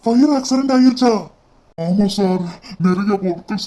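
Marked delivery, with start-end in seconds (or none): 0.0-1.1 loud, alarmed disbelief at the news; shocked disbelief. Acted. Boss hearing of the office fire, shocked and loud. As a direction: blurt it out, stunned and loud.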